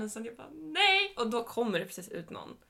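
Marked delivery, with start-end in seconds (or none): 0.5-1.1 high, very loud retold shout; shouted refusal. Woman refusing a stranger's lift, shouts back high and loud. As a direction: yell the refusal right back.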